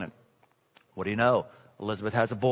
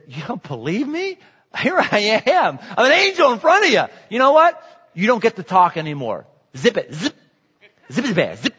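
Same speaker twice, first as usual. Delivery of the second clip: annoyed angel; 4 semitones higher than usual. Retold or imitated. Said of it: "Gabriel, gets ticked".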